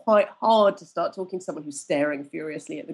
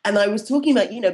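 ordinary talk

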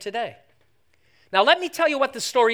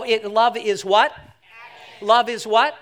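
ordinary talk, nothing marked

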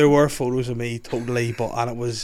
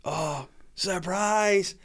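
drawn-out, higher, awkward "surprise"; retold sheepish surprise; 7 semitones higher, much slower than usual. Son caught in a hat by his mum, sheepish surprise. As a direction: stretch it out like someone caught out.

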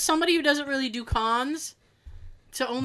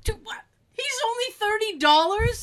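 surprised; 11 semitones higher than usual. Real, not acted. Shocked at price, high.

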